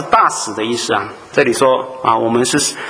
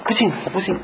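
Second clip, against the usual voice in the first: softer, stubborn, a different voice digging in; stubborn refusal; 6 semitones higher, 5 dB quieter, faster than usual. Acted. Enemy nation refusing the ambassador's peace message, softer and stubborn. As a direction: refuse flatly, like a mulish skeptic.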